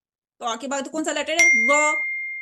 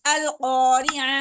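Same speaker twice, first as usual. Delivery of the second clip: slow model; 4 dB louder, much slower than usual. Shown as a demonstration. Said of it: "teacher models word".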